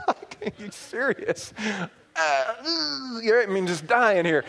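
high, slow mock groan; strained disbelief. Son at the gym beside his strong dad, straining incredulously. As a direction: squeeze it out as if struggling under weight.

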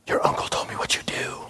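a hushed, secretive whisper; whispering to the pastor at dinner. Retold or imitated. Woman leans in confidentially.

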